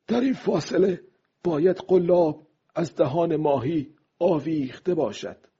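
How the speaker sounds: high-pitched character voice for his thoughts; old fisherman reasoning to himself, high voice; old man thinking. A narrator's reading. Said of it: "پیرمرد اندیشید".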